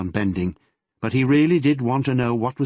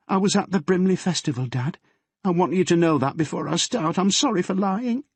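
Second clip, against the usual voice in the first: put-on higher, earnest girl's voice; nervous girl confessing to her father; teenage daughter Yvonne; 10 semitones higher than usual.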